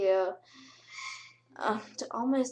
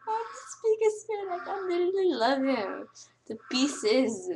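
gushing fan; 7 semitones higher, 3 dB louder than usual. Retold or imitated. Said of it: "I was like".

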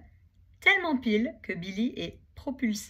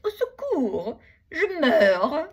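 hammed-up higher, louder moan; fake dying wail; 7 semitones higher, 10 dB louder, much slower than usual. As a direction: overplay dying to lure the bison. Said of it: "se met à gémir, comme s'il était blessé". (gémissement de mourant exagéré)

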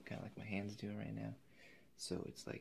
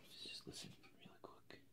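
soft breathy whisper, much quieter; hushed whisper; 12 dB quieter than usual. Acted. Painter hushing the listener to hear the canvas, whispered. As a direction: lean in and barely breathe the words.

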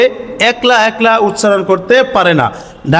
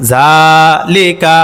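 জালিকা stretched very slowly, lower; syllables of জালিকা held long; drawn-out reading; 3 semitones lower, much slower than usual. Shown as a demonstration. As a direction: draw out জালিকা in a slow lilting chant. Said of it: "তাড়াতাড়ি করিয়া পড়িতে হয়".

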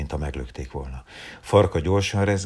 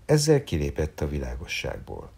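plain reading voice

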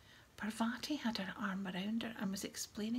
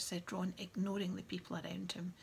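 ordinary delivery, nothing marked

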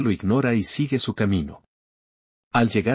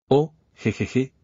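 a slow, higher nervous "oh, jejeje"; nervous chuckle; 4 semitones higher, slower than usual. Acted. Lin Yantian caught staring, covering with a nervous chuckle. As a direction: laugh it off like you've been caught.

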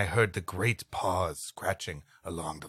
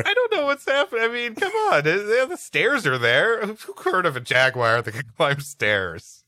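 higher, louder laughing complaint; exasperated laugh; 11 semitones higher, 7 dB louder than usual. Real, not acted. Reader cracking up at a stair-climbing jaguar. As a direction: laugh at how absurd the tale is. Narrator laughs at own story.

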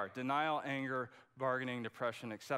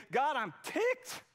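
higher, slow, mock-indignant complaint to God; imitating a frustrated believer, slow and raised; mock angry outburst; 10 semitones higher, 4 dB louder, slower than usual. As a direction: blurt out a half-playful angry protest. Voicing honest anger at God.

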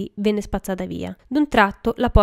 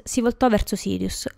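usual speaking voice

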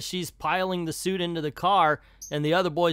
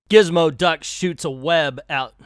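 ordinary talk

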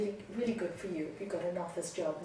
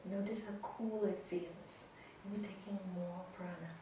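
soft spa whisper; 2 semitones lower, 12 dB quieter than usual. Acted. Guided breathing continues.

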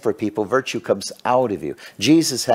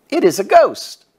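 much higher, louder terrified cry of the disciples; frightened cry; 7 dB louder than usual. A narrator's reading. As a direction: cry it out like you've just seen a ghost. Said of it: "they were troubled, saying".

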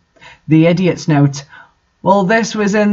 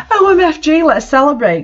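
higher, hammed-up squeal of excitement; excited gasp; 8 semitones higher than usual. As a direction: gush it out like a giddy teen. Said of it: "gasped Willow".

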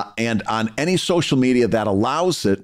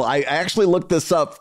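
ordinary delivery

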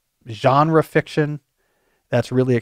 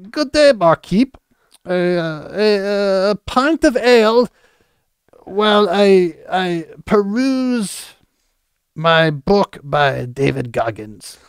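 high, slow, hammed-up posh English pub accent; 7 semitones higher, much slower than usual. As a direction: play a pompous English gentleman ordering ale, stretching every word. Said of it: "talk like that".

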